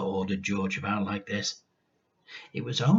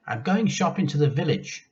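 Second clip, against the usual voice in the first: mother's voice; 6 semitones higher, 3 dB louder than usual. Acted.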